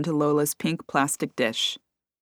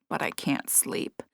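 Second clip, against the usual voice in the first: whispering; 3 semitones lower, 8 dB quieter than usual. A narrator's reading. Sleepless hushed reply.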